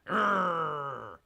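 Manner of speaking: loud, drawn-out mock growl. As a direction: growl like the grudge-holding rival leader. Mimics Augustus's growl again.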